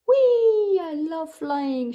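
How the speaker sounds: high, loud squeal of delight; joyful shout. A narrator's reading. Peppa on her broomstick, squealing with joy. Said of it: "shouted Peppa".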